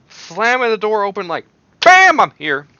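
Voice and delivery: loud high scream, bursting in. Retold or imitated. Vlogger describing the first stink bug flavor, screaming. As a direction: yell it like kicking the door open. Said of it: "Sorry for screaming".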